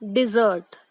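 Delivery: dessert said "डिज़र्ट", stress on the second syllable; teacher's model: "dessert" as "डि-ज़र्ट"; correct pronunciation. Shown as a demonstration. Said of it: "I repeat". (सही उच्चारण: dessert को "डिज़र्ट" बोलें)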